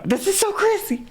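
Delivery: high-pitched amazed exclamation; real amazement. Real, not acted. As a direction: marvel at it, pitch leaping way up. Amazed at hearing old voice clips.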